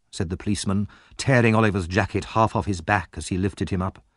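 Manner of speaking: plain reading voice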